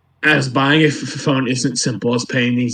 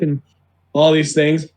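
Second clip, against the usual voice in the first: ordinary delivery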